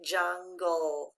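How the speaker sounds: "jungle" slowly in two parts, jun-gull; low, measured "jungle", each syllable distinct; syllable-by-syllable reading. Shown as a demonstration. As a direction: sound out "jungle" low, both syllables stretched.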